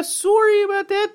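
high, laughing mock Canadian voice; laughing high Canadian impression; Canadian accent. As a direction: say sorry like a polite Canadian.

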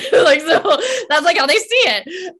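laughing loudly through the words. Real, not acted. Coach cracking up after her own impression, laughing hard. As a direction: bubble over with laughter as you say it.

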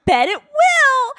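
high, slow strained politeness; mock upbeat reassurance; forced cheer. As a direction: force brightness through doubt. Forced polite reply to father.

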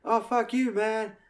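high, jeering imitation of the killer; high mocking killer impression; Kevin Spacey's killer voice. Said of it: "he's doing an accent".

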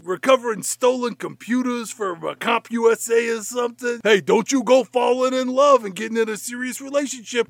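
high, hammed-up police chief telling off his cop; mock-stern boss ribbing a reckless officer; a cop movie chief razzing his star; gruff chief.

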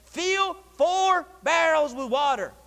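high, slow, spaced command; called-out order. Retold or imitated. Elijah ordering water for the altar, called-out order. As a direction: call it out word by word.

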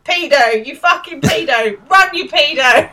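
high, loud jeering yell; shouting. Retold or imitated. Girls chase Simon off with abuse, acted-out shouting. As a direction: imitate girls yelling abuse after someone. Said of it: "start shouting".

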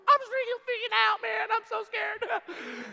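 very high and loud, breaking into a fake cry; hammed-up tearful wail; mock sobbing panic. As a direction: whimper it out like a scared kid. Mock crying freak-out.

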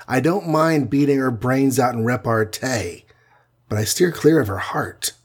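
ordinary delivery

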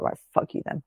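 unvoiced, half-hearted dismissal; imitated whisper. Imagined match ending the argument, in a whisper. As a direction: breathe it out as a sulky goodbye. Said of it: "he'd be like".

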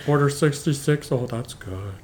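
quiet, halting director imitation; understated filmmaker impression; George Lucas. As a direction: mumble along softly in character, with little ums. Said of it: "how does George Lucas sound?".

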